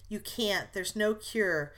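ordinary delivery

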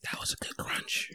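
very soft whispered remark; near-silent whispery aside; hushed commentary. Real, not acted. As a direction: whisper your approval, barely audible.

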